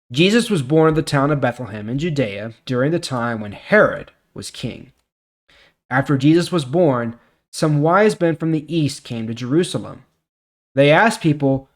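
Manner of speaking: measured, clear Bible story narration; steady storytelling read; scripture reading. Acted. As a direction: read the passage like telling a story to the congregation. Reads scripture passage aloud.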